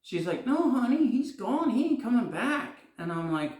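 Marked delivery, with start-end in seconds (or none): 0.5-2.8 high, lightly voiced sweet lady; sweet bartender lady. Bartender kindly tells fan the star left. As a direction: gently let him down, honey.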